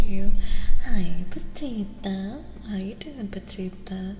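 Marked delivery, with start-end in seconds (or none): none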